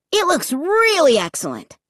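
put-on high-pitched keen boy; insisting. A narrator's reading. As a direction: push harder to persuade, pitch up. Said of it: "Luke insisted".

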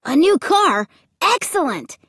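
high, thrilled outburst; excited exclamation. A narrator's reading. Luke hearing about the new car, excited. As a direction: exclaim with delight at the prize. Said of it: "Luke exclaimed".

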